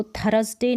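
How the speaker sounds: थर्सडे misread in three syllables, r sounded; wrong THURSDAY pronunciation. Shown as a demonstration. Teacher warning learners how not to read it, थर्सडे with sounded r. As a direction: say थर्सडे as "tha-ras-day". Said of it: "इसको थर्सडे नहीं पढ़ेंगे".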